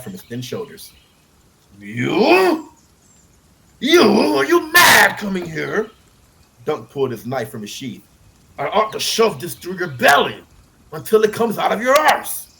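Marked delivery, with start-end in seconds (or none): 1.8-5.9 loud, high, slow furious shout; angry shout. A narrator's reading. Dunk confronts the prince. 8.6-12.5 loud, higher threatening growl; furious threat. A narrator's reading. Dunk threatens with knife.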